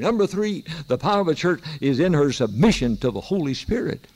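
impassioned sermon delivery; fervent preaching. Acted. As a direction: preach it out to the congregation. Returns to preaching points.

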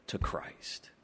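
hushed, breathy whisper to close. Acted. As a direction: drop to a sudden whisper. Sermon point dropped to a hush.

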